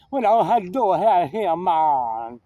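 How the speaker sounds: mock Jamaican accent, drawn-out "man". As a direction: imitate a Jamaican store clerk. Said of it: "And when he was Jamaican".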